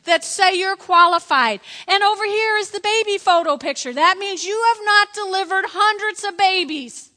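retold exasperated dressing-down; emphatic rebuttal. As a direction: lay down the law at full voice. Wife's acted rebuttal to husband.

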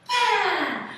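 high, loud "bam"; imitated impact. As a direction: punch it out as a sound effect. Imitates the child landing.

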